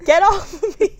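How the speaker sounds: high, loud get-off with a laugh; mock shove-off. Mom imagining shaking off a clingy pet, loud and laughing. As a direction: brush off a clingy cat, laughing. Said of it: "I'd be like".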